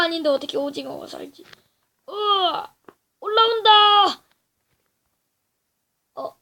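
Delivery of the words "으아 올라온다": high, loud panicked cry; panicked yell. Real, not acted. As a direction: scream as it climbs up. Octopus climbing toward him. (겁에 질린 외침)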